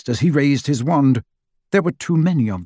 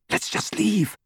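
soft, higher-pitched urgent whisper; 9 semitones higher, 6 dB quieter than usual. A narrator's reading. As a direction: whisper it urgently to your friends. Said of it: "Hermione whispered".

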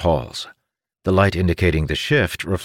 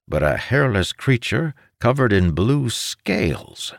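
plain reading voice

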